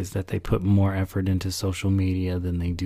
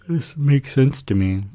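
said through a big real yawn, higher and louder; 5 semitones higher, 8 dB louder than usual. Real, not acted. Podcast host yawning while talking about her photography. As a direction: stretch the words out mid-yawn. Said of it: "I keep yawning".

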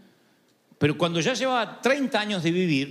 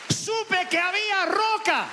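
higher, slower ringing proclamation; soaring repeat; 12 semitones higher, slower than usual. Real, not acted. Preacher repeats his victory to the church, high and slow. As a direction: stretch it out high, full of faith.

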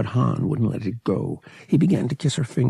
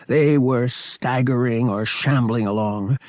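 usual speaking voice

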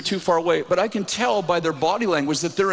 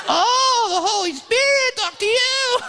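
mocking, very high falsetto; brain's voice; slower than usual. Brain ridiculing the preacher's faith, squeaky mockery. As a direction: sneer in a squeaky cartoon voice. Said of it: "in, in, in this voice".

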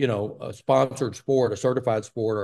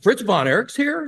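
starstruck surprise; 10 semitones higher, 4 dB louder than usual. Retold or imitated. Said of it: "I'm like".

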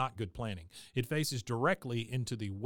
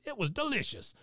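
put-on high, smug little-old-lady voice; a petty old lady gloating; 9 semitones higher than usual. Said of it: "and then she says".

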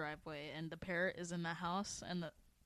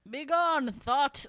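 loud, high, theatrical "be gone"; booming mock command; 7 semitones higher, 11 dB louder, much slower than usual. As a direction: bellow it like a grand dismissal. Shouted parody of lizard line.

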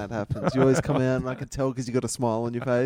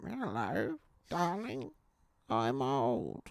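put-on a lightly shaky elderly granny; shaky old voice; 9 dB quieter, much slower than usual. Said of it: "Do an impression of an old person".

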